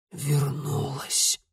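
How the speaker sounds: slow hoarse whisper through a hurt throat; pained hoarse whisper. Acted. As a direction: barely get it out, throat aching. Throat injured, trying to speak.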